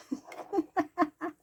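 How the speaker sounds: high, amused chuckle; laugh. Real, not acted. Grandma tickled by granddaughter's criticism, laughing. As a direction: laugh at your own story. Laughing at her own story.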